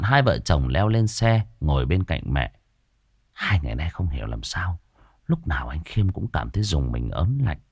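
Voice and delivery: ordinary delivery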